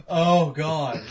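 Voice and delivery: ordinary talk, nothing marked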